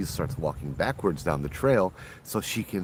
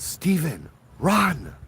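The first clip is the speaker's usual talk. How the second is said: high, louder cry of alarm; urgent warning; 10 semitones higher, 3 dB louder, much slower than usual. Acted. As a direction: warn him to flee. Old woman's urgent warning in ghost story.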